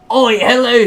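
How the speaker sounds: high, loud mock British caller; British accent. Another complainer ringing Visa, loud mock British accent. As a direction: bellow a greeting in a put-on British accent. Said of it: "they all have British accents".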